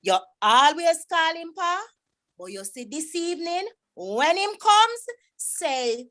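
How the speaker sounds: high-pitched, scolding Jamaican Patois recitation; dramatic poem rendition. Acted. Performing Louise Bennett's poem on request, scolding a returned son, dramatic recitation. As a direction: perform the poem as an exasperated parent's lament. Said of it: "This is my rendition".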